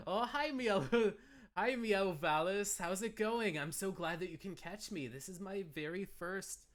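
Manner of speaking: high, loud, delighted welcome; excited greeting. Real, not acted. Streamer spotting Miao arrive in chat, excited greeting. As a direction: greet with a high-pitched burst, easing slightly.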